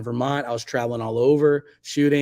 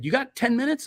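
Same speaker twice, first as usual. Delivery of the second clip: eager friend; 11 semitones higher than usual. Retold or imitated. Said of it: "He was like".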